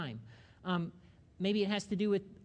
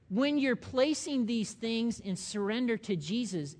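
normal talking voice

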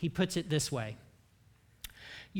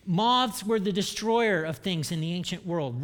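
ordinary talk

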